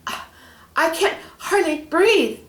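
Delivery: high, loud breathless gasp; squeezed, fighting for air. Acted. Peter gasping in the snake's grip, high and loud. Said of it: "gasped Peter".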